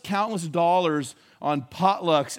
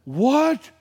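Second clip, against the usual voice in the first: high, loud, stunned what; shocked what; 7 semitones higher, 6 dB louder than usual. Retold or imitated. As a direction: blurt it out in disbelief. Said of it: "And he goes".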